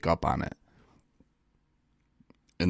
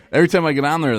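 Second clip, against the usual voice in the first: restarts the line while still laughing, quick and high; giggling after a cheeky joke; laughing through it; 9 semitones higher, 8 dB louder, much faster than usual. Real, not acted. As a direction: laugh it off and hurry on. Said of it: "that was a joke".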